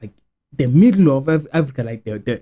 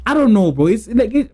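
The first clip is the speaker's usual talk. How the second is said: usual speaking voice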